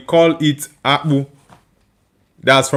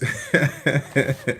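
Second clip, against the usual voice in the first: laugh; 4 semitones lower, 10 dB quieter than usual. Real, not acted. Laughing at a viewer's reaction.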